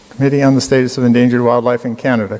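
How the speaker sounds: ordinary delivery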